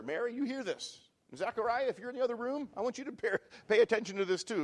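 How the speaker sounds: mild Elizabeth exclaiming. Retold or imitated. Elizabeth calling to Mary and Zechariah, mild mock exclaim. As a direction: call it out a touch so the next room hears. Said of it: "in a loud voice, Elizabeth exclaimed".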